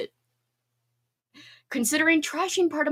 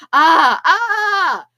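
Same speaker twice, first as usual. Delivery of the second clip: excited yelp; 6 semitones higher, 9 dB louder than usual. Real, not acted. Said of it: "I should have yelled".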